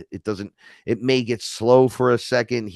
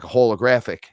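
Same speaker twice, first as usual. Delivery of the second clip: ordinary talk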